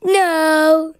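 loud put-on silly monster voice; grumpy guitar voice. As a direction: bark it out as a cranky guitar. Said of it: "Are you happy being guitars?".

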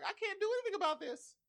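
high, quick squeal of helplessness; hammed-up, high-pitched whine; mock helplessness. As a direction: squeak it out in comic despair. Voices helpless character being mocked.

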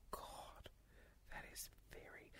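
breathy shocked whisper. Real, not acted. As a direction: whisper it, stunned. Shocked after a dark joke.

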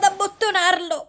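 squeezed out with held breath; holding breath. Acted. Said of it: "se trattengo un po' il fiato".

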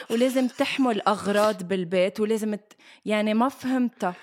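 usual speaking voice

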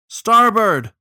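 sudden high, loud yell; yelled command. A narrator's reading. Captain warning crew as the bank closes in, yelled. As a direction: yell it as an urgent order. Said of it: "Fitzelmo yelled".

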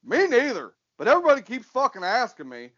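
a hint of a drawling friend; Ben Snur voice. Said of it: "my Ben Snur voice".

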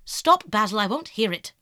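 loud, sharp protest; urgent, forceful outburst; crying out. A narrator's reading. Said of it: "cried Dorian".

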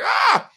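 sudden high yelp; panicked yelp. Retold or imitated. Recalling the scooter mix-up, a high startled yelp. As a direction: squeal in a flash of panic. Scream during scooter crash.

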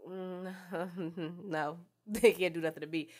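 a smug, laughing brush-off; arrogant dismissal. Retold or imitated. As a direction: shrug off the threat with a chuckle. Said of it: "strictly arrogance".

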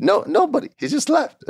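high, squeaky disbelief; incredulous. Real, not acted. Newcomer left without a crew, incredulous. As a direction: voice shoots up in exasperation.

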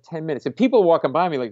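usual speaking voice

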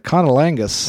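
put-on drawled deep South accent, Colonel stretched to Connel. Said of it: "a super deep South accent".

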